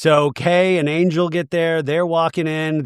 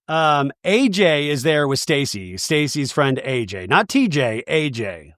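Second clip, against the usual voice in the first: ordinary talk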